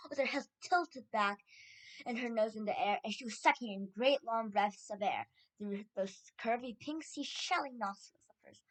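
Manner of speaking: ordinary delivery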